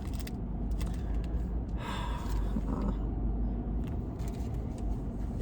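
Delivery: long breathy tearful sigh; real grief sigh; shaky sigh. Real, not acted. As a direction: let out a trembling breath. Emotional over dog's hair.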